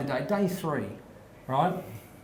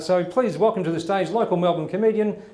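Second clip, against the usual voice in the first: building announcer call, louder to the name; announcer intro; 3 semitones higher, 4 dB louder than usual. Acted. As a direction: hype up the guest's entrance. Introducing guest to the stage.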